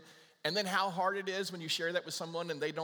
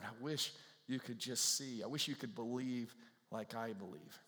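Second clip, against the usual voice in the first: heartfelt longing; 5 semitones lower, 7 dB quieter than usual. Retold or imitated. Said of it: "with all your heart, you're going".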